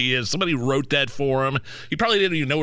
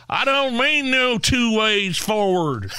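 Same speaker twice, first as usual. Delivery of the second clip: mock hillbilly accent; 5 semitones higher, slower than usual. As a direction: ham up an Appalachian hillbilly. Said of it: "talking like a backwoods redneck".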